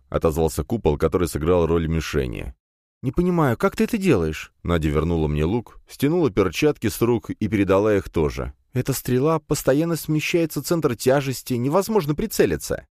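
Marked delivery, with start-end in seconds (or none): 3.0-4.5 put-on high, puzzled girl's voice; young woman's voice. A narrator's reading. Nadya voiced by narrator. 8.7-12.9 put-on high, frustrated girl's voice; young woman's voice. A narrator's reading. Nadya complains about arrow.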